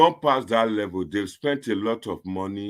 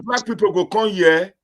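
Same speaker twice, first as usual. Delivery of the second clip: shouting over; 9 semitones higher, 5 dB louder than usual. Real, not acted. Shouting over opponent in debate.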